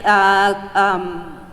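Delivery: usual speaking voice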